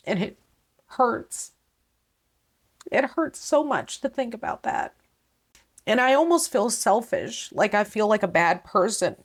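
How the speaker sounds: choked-up, tearful and high; crying through the words, voice straining; ugly crying. Real, not acted. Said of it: "see me ugly cry".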